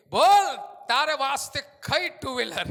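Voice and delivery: loud, high call; God demanding which bike; booming question. Acted. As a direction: Lord asking loudly. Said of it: "પ્રભુ કહે".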